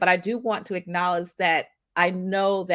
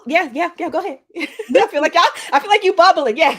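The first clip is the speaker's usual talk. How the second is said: high, loud, laughing chatter; 9 semitones higher, 8 dB louder than usual. Real, not acted. Host urging her bubbling co-host to jump in, laughing. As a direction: talk excitedly through your own laughter.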